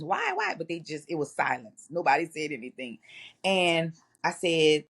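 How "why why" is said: put-on kids asking why. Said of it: "they usually ask questions".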